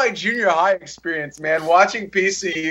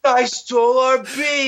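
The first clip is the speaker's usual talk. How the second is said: higher, slow dopey stoner; 7 semitones higher than usual. Retold or imitated. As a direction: confess it like a dazed stoner. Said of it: "our high guy impression".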